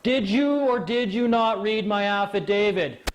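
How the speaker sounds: high, drawn-out retold call; hammed-up, emphatic demand; forceful interruption. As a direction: cut through her rant slowly and insistently. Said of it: "he had to interrupt her".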